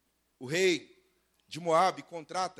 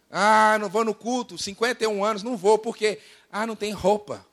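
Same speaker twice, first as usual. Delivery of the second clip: exaggerated complaining excuse; mock grumbling; 3 semitones higher, 4 dB louder than usual. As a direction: imitate someone moaning about the wardrobe. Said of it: "Gente que murmura".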